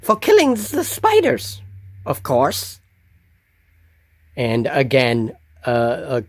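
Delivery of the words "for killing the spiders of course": high goblin voice, matter-of-fact; the goblin states the obvious; goblin guard. Acted. Goblin guard answering Varric about registering, high goblin voice.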